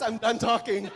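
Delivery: loud, high, through a laugh; mock sign-off, breaking into laughter. Real, not acted. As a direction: joke it out loudly while starting to laugh. Joking reaction to audience answer.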